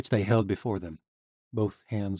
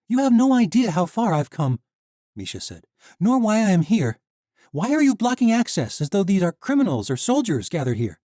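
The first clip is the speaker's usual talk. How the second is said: put-on high, loud young heroine; defiant young woman; 12 semitones higher, 8 dB louder than usual. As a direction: stand up to the guard. Misha confronts the knight.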